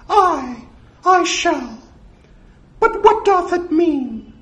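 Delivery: squeaky, very high falsetto; high-pitched nervous mouse voice; Mickey. Acted. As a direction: answer the master in a cowed cartoon squeal.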